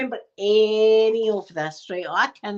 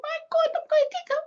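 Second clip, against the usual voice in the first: much higher, wide-eyed squeak; squeaky awe; faster than usual. Retold or imitated. Kid overhearing mum, high awed squeak. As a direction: gasp it up high like a thrilled kid. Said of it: "child awed by mum".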